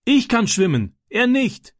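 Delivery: high, urgent shouted reply; calling back. A narrator's reading. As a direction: yell the answer back to shore. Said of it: "rief Francis zurück".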